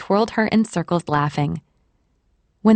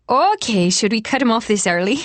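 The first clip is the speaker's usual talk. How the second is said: lilting, higher Irish waitress; Irish accent; 3 semitones higher than usual. A narrator's reading. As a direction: give it an Irish lilt, pitched up. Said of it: "Her Irish accent added a note".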